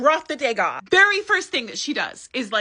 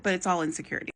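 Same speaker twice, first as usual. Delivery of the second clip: "it's all insecurity" said softer, falling away; softer repeat; 4 semitones lower, 7 dB quieter, faster than usual. Shown as a demonstration. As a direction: repeat it quietly to yourself. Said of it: "I mean, it doesn't excuse it".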